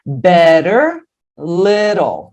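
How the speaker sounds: "Better, little" with the T tapped like an R. Shown as a demonstration. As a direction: say "be-rer, le-rol". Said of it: "você já faz isso no better". ("Better, little" com o T soando como R)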